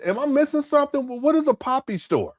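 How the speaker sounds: real disbelief at a strange phrase; confused and incredulous; baffled. Real, not acted. Baffled by the "poppy store.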